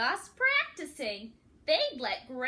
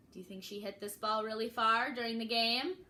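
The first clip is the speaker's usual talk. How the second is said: ordinary delivery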